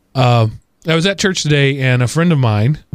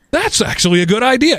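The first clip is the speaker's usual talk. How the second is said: high-pitched mock astonishment; teasingly amazed that the idea is good; overdone surprised praise; sarcastic surprise; 11 semitones higher than usual. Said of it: "be careful about sarcasm".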